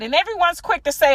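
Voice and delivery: usual speaking voice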